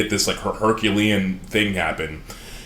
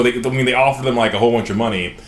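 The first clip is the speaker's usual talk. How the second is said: usual speaking voice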